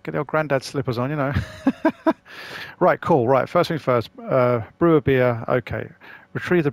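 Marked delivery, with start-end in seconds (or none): 1.4-2.7 breathy high-pitched giggle; a quick airy laugh at the joke; high unvoiced giggle. Real, not acted. Laughing at his own granddad slippers joke with a high giggle.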